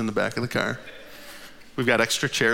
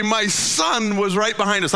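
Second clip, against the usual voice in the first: ordinary delivery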